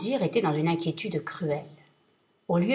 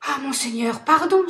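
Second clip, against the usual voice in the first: pardon cried out high and loud; a frightened plea flung at the genie; desperate cry for mercy; 7 semitones higher, 5 dB louder than usual. Acted. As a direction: scream for mercy, pitch leaping up. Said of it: "s'écria le marchand".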